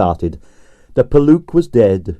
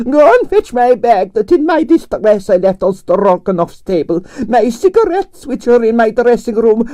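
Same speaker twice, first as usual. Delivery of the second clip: grande dame Russian ballerina; 12 semitones higher than usual. A narrator's reading. Narrator voicing Arienskaya, imperious and high. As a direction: sigh it out like a tragic prima donna.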